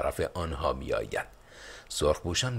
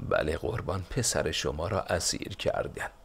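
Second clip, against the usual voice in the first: ordinary delivery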